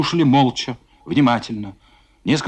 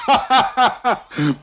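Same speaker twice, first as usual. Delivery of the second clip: guffaw; 7 semitones higher than usual. Acted. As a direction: roar with scornful laughter. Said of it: "Фома хохотал".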